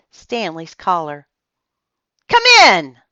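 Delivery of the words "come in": loud, high call from behind the door; loud command. A narrator's reading. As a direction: grant entry gruffly.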